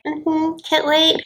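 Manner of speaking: high mock-agreeable voice; imitating Brittany. Woman humouring her online suitor, high put-on agreeable voice. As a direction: humour him in a high little voice. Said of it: "And she's like".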